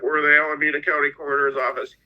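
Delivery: rattled off quickly, like a rushed official; mock-brisk, fast official announcement; imitating fast talk. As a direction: hurry through it like a coroner reading a script. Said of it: "He talked real fast".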